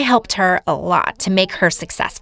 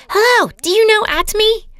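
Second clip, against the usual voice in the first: very high-pitched, raised-voice yell; screamed greeting; 13 semitones higher, 4 dB louder than usual. Retold or imitated. Seller entering a store to pitch Atomy, screaming it out. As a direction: shout the hello, pitch leaping way up. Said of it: "we entered a store and I screamed".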